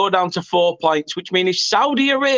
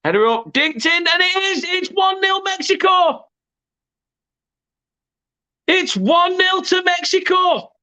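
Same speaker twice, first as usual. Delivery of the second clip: excited goal call; 10 semitones higher than usual. Real, not acted. Commentator calling Mexico's goal to viewers, excited high shout. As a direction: voice leaps high as the goal goes in.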